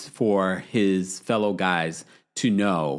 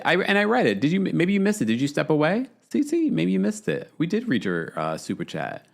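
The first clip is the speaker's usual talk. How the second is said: ordinary talk, nothing marked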